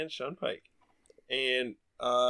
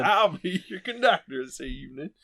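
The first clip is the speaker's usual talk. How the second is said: mock Wild West accent; 5 semitones higher than usual. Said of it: "like a Wild West thing".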